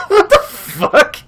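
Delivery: slow, high, laughing what-the; cracking up in disbelief. Real, not acted. Reacting to frozen smoke.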